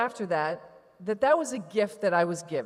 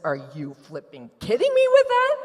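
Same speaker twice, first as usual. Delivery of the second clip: mock flip out; 3 semitones higher, 7 dB louder than usual. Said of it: "this would have elicited".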